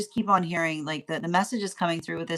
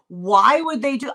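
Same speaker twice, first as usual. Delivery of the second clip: ordinary delivery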